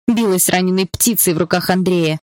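ordinary delivery

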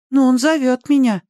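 put-on high child's voice, mildly puzzled; puzzled boy. A narrator's reading. Danny answering Ali's warning, puzzled. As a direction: answer like a bewildered little boy. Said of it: "озадаченно ответил Дэнни".

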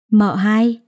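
disbelieving double-take on the title; young master stunned to hear he has a wife; surprised question. Acted. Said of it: "Cậu tròn xoe mắt ngạc nhiên hỏi lại".

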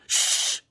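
loud sharp "shh"; shushing. A narrator's reading. Berto silencing Ario, a forceful shush. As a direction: hush someone urgently.

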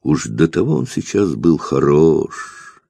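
ordinary delivery, nothing marked

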